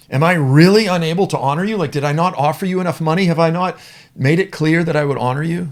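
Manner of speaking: king Balak berating Balaam over money; indignant king. Acted. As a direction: insist you paid him enough.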